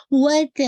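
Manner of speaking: وَتَ with ت on the gum line, loud; precise ta. Shown as a demonstration. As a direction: hit the ت of وَتَ crisply. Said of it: "meaning تا نہیں ہے".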